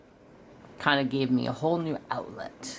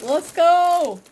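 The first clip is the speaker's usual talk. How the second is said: much higher, louder, cheering "let's go"; excited cheer; 8 dB louder than usual. Retold or imitated. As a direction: burst out like a kid who just got a gift. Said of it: "I was like".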